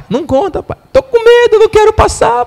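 put-on a frightened child begging her father; scared child. Frightened daughter pleading with her father on the rocks, high and scared.

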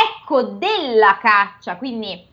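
ordinary delivery, nothing marked

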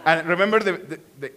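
ordinary delivery

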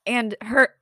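catching in the throat; choking a little mid-sentence. Real, not acted. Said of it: "I'm, like, choking today".

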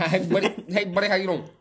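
high, loud southern buddy greeting; southern cousin voice. Retold or imitated. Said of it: "He talks real fast like this".

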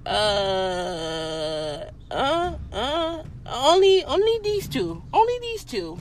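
high, halting, flustered imitation; lightly voiced hesitant nail tech.

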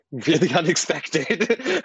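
real chuckle running into the words; amused laughing. Real, not acted. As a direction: laugh as you say it, drawn out. Laughs recalling the surprise.

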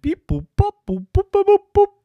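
high, loud staccato beeps; child's excited robot impression; imitating a robot. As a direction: mimic a toy robot's bleeps. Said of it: "I went".

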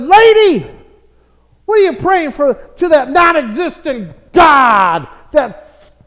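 loud, challenging call; acted neighbor loudly contradicting a believer; scoffing atheist. Preacher acts a scoffing atheist neighbor.

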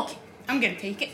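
rushed, low and offhand; fast dismissive. Retold or imitated. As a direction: rattle it off without waiting. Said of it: "I'm like".

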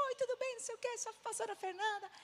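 high, sing-song polite hello; chirpy greeting. Retold or imitated. Pastor introducing herself at a stranger's door, high chirpy greeting.

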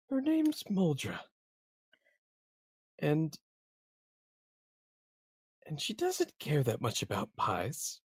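lightly acted tearful whine; tearful pleading. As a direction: plead through tears for the mule. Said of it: "he's tearing up".

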